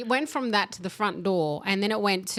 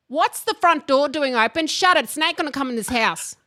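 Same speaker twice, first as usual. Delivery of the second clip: mock scolding mother, higher and louder; scolding mum; 5 semitones higher, 5 dB louder than usual. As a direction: scold about the open door like an angry mum. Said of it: "my mum would go".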